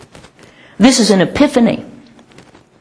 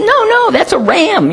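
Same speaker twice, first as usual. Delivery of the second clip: put-on much higher child's voice; protesting little boy; 12 semitones higher than usual. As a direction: imitate a boy objecting, high-pitched. Voices the boy rejecting the drawing.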